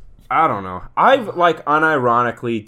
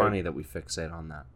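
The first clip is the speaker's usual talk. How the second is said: therapist voice; 8 semitones lower, 10 dB quieter than usual. Acted. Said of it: "That was my impression of a therapist".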